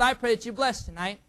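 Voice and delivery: ordinary talk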